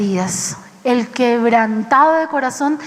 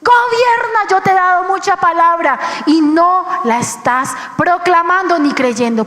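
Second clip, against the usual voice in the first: God shouting; 4 semitones higher than usual. Retold or imitated. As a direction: call it out like a command from above. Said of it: "Y Dios me gritaba".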